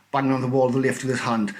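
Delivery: ordinary delivery, nothing marked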